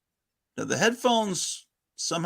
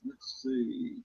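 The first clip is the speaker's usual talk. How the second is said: echo from second device; 3 semitones lower, 12 dB quieter than usual. Real, not acted. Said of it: "Audio echo".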